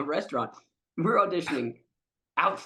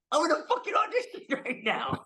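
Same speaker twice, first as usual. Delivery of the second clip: laughing disbelief; 11 semitones higher than usual. Retold or imitated. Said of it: "I'm like".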